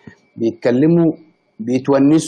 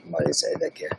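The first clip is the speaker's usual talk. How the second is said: ordinary talk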